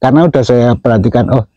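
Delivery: usual speaking voice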